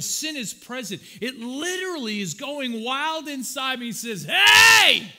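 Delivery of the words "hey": sudden loud alarm call; shout. Retold or imitated. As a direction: bark it like an alarm going off. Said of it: "That's how the Holy Spirit speaks to me".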